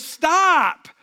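high, loud, drawn-out halt; commanding shout. Retold or imitated. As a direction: cut him off with a booming order.

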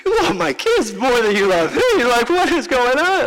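a playful whine at a softened father; mock jealous son. Pastor quoting himself teasing his father, mock complaint. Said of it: "it's like".

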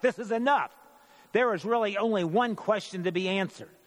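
high, lifted outcry to halt proceedings; crying out. A narrator's reading. High priest calls a halt to the trial, crying out. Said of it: "he cried out".

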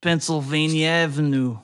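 slow, clumsy fake Russian accent; hammed-up Russian accent, slow; bad Russian accent. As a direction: play a gruff Russian, badly. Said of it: "just a terrible Russian accent".

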